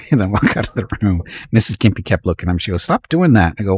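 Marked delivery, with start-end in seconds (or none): 2.8-3.5 higher, louder mock scold; retold scolding. Imitating the wife scolding him, a higher, louder mock scold. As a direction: play the wife telling him off.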